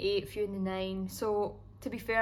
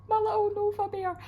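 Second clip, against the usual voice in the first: cutesy puppy voice; 13 semitones higher, 7 dB louder than usual. Real, not acted. Missing her puppy back home.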